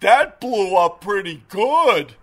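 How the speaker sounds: a slow, lightly put-on buddy voice; imitating Kenny. Host imitating his driver after the pigeon hit, slow and amused. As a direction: slowly savour the gross moment, lightly voiced. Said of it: "And Kenny goes".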